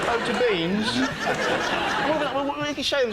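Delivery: high laughing punchline; laughing at his own bit. Real, not acted. Comic giggling high while joking.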